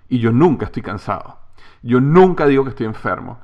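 normal talking voice

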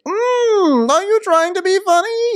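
very high, loud, hammed-up wife calling out; Karen. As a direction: sing it out high to your husband. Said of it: "it's Karen and Ray".

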